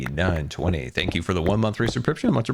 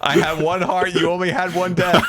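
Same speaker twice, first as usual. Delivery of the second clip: high, louder complaint; exasperated, raised voice; indignant protest; 8 semitones higher, 5 dB louder than usual. Real, not acted. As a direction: protest loudly at the unfairness. Protests after dying in game.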